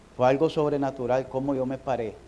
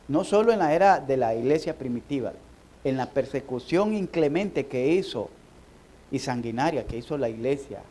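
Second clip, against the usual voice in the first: ordinary talk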